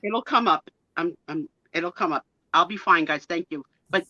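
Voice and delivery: ordinary talk, nothing marked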